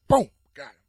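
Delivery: loud burst of victory; yelling boom got him; triumphant shout. Retold or imitated. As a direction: slam it out like you just won. Winning arm wrestle, triumphant.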